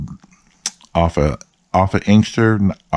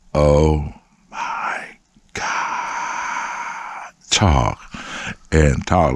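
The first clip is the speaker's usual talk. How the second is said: drawn-out repetition; 2 semitones lower, much slower than usual. Retold or imitated. How much Tanya talked.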